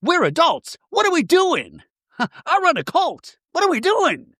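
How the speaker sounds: shrill send-up of an indignant housewife; mimicking Mary. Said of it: "And then Mary going".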